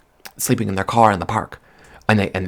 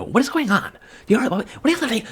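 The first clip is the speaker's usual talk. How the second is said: high, panicked questions; shrill-pitched freakout over falling meteors; frantic; 14 semitones higher, faster than usual. Real, not acted. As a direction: spiral into alarm at the sky. Panicked rant about meteors.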